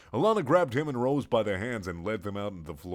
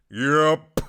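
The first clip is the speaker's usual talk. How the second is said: loud yep; 5 semitones higher, 9 dB louder than usual. Acted. Alana answers loudly.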